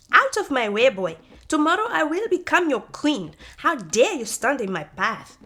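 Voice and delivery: loud, high, imperious; haughty shout. Acted. Manyara shouting the boy out of her way, high and loud. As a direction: drive him out of the path.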